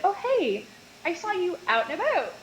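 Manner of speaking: high, upbeat Canadian greeting; Canadian accent. Retold or imitated. Yoga teacher greeting the mate after a night out, Canadian accent. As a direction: hail someone in a Canadian accent. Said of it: "in my Canadian accent".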